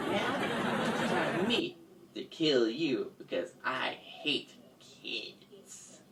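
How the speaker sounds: soft villain menace sinking to a whisper; evil villain. Acted. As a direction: threaten softly, almost whispering at the end. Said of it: "she was getting evil with her laughing".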